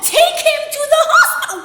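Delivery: very high, loud mock shriek; alarmed yelling. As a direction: cry out in exaggerated disbelief. Alarmed reply about burned child.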